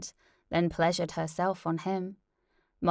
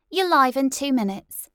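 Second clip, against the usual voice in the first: producer call; 7 semitones higher, 8 dB louder than usual. Acted. Producer warning.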